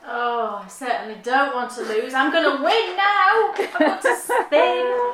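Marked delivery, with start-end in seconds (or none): 2.1-5.1 high, rising wail, slowing at the end; comic desperation. Real, not acted. Host facing a spinning forfeit vows to win, comic desperation. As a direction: vow it loudly, then stretch out the woe.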